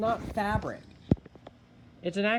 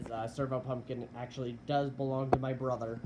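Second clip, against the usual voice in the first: chewing ice; 5 semitones lower, 5 dB quieter, faster than usual. Real, not acted. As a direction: mouth full of ice while talking. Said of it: "Sorry, I'm just chewing on ice".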